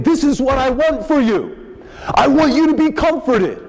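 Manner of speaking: high, earnest voice of God speaking; God's voice. Acted. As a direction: speak as God pleading tenderly.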